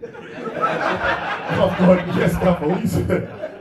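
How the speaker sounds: caught-out laughing reply. Retold or imitated. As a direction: blurt it out while cracking up. Caught out by the manager.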